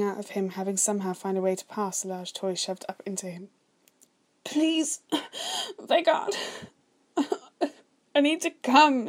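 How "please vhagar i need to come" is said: high, breaking, tearful begging; sobbed plea. A narrator's reading. Man left alone calling to his brother, sobbing. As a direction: beg desperately in a high voice. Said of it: "he sobbed".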